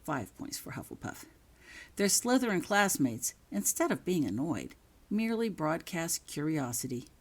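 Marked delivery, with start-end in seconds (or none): 0.0-1.3 soft whispered aside, no voice; hushed whisper of good news. Acted. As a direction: breathe it as a secret aside. Aside announcing house points.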